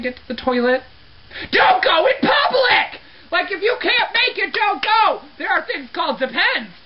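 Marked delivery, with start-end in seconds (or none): 1.5-5.2 high, exasperated shouting; worked up. Acted. Cleaner ordering the phantom to stay home, worked up. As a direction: yell the order with stretched, indignant words. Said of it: "Got a little worked up".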